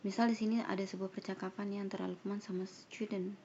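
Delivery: ordinary talk, nothing marked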